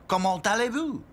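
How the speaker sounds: high, theatrical French question; French wizard. A narrator's reading. Wizard testing the princess dolls, French accent. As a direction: ask it with a put-on French accent.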